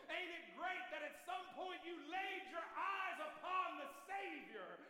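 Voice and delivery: high preaching climax; preaching at full force. Acted. Preacher crying out to congregation, high and fervent. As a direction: lift it up and shout the good news.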